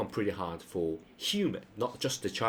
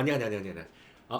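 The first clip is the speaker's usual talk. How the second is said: 你好 chattered rapidly as one run; businessman's greeting; much faster than usual. Acted. Businessman greeting someone informally, quick repeated hello. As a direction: rattle off a chummy, repeated 你好. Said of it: "like a businessman".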